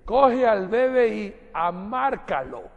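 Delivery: slow, drawn-out highland Ecuadorian voice with "amárcalo" stretched out; mock the slow sierra Ecuadorian speech. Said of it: "¿Cómo se dice?". (acento serrano, lento y arrastrado)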